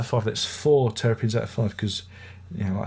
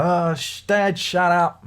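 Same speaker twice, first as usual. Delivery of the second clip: put-on higher, slow whiny kid telling dad to hush; mock teenage kid; 6 semitones higher, 3 dB louder, slower than usual. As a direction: imitate the kids brushing him off. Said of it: "They go".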